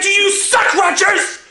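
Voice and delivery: put-on bratty kid yelling insults at a teammate; furious kid. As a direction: sneer it like a bratty bowler. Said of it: "Scotty would be furious".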